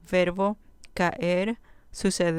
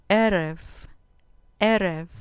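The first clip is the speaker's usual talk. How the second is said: Erev stressed on the first syllable, E-rev, said louder; 4 dB louder than usual. Shown as a demonstration. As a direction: hit the E of Erev, then let rev fall away. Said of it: "con acento en la penúltima sílaba".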